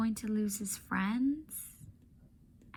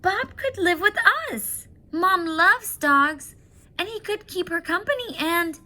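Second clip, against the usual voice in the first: much higher and louder, bursting with an idea; excited girl; 10 semitones higher, 8 dB louder than usual. Acted. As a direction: pitch the plan eagerly to dad. Julia excited plea.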